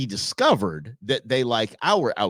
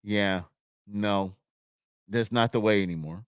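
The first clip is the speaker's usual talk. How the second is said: usual speaking voice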